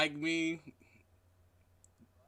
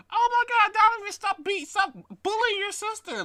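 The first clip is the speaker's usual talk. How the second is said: very high, softer grandma imitation; mock grandma scolding; 6 dB quieter than usual. Friend acts out grandma scolding Donovan, mock grandma voice. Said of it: "how that first conversation went".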